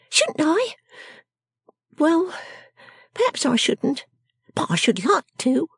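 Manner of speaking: high, contrary character voice; quaint little shrew. Acted. Miss Jenny answering Lizzie, high and contrary. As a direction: concede grudgingly in a high little voice.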